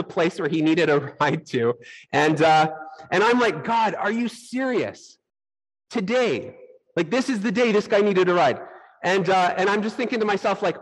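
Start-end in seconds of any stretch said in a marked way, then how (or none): 3.6-8.6 incredulous protest, pitched up; exasperated disbelief. Retold or imitated. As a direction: retell furious disbelief without shouting. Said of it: "I'm furious".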